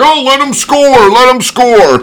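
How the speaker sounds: high, loud, drawn-out sideline plea; imitated dad hollering. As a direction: call it out like an indulgent dad. Dad shouting from sideline, retold.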